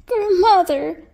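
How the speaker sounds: put-on high, loud cry of a little girl; a child crying out high and loud. A narrator's reading. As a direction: call out to grandmother from the heart. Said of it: "cried the little girl".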